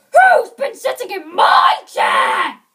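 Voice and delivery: huge, loud roaring shout; great big bear voice. A narrator's reading. Father Bear at his chair, great big voice. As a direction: bellow like an enormous bear. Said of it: "in his great big voice".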